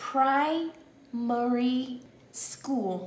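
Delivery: Primary school said very slowly, each syllable drawn out; very slow drill. Shown as a demonstration. Teacher modelling for the class, very slow and drawn out. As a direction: stretch every sound of Primary school. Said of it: "Âm u kéo dài ra".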